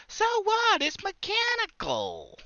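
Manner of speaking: high, slow cartoon rabbit voice; squeaky cartoon bunny quip; Bugs Bunny voice. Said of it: "reference a Bugs Bunny cartoon".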